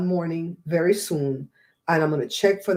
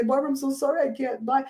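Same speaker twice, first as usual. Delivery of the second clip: mock apologetic fan; 5 semitones higher than usual. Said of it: "Don't say".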